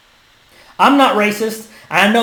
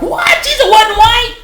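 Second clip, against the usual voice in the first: high-pitched, loud mock outrage; hammed-up, squealing incredulity; mock shock; 14 semitones higher, 5 dB louder than usual. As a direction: imitate a scandalized shriek of disbelief. Imitates shocked people reacting.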